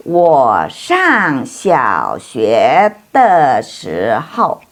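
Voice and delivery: very slow, syllable by syllable; slow model reading. Shown as a demonstration. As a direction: stretch each syllable so students can copy. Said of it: "teacher models Chinese answer".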